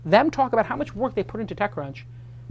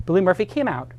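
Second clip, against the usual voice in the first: normal talking voice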